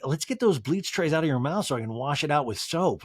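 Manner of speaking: normal talking voice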